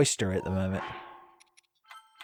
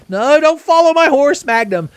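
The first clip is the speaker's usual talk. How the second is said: high, loud panicked yell; 11 dB louder than usual. Real, not acted. Gamer yelling at his horse to stay back, panicked. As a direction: shout the horse off. Horse chasing him into danger.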